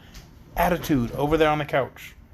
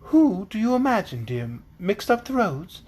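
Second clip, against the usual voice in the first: higher-pitched, dainty British princess; dainty British female voice; 6 semitones higher than usual. Acted. Princess questions Dorothy about the mixed-up roads, dainty British voice. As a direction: play a dainty British lady. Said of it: "do my dainty female British voice".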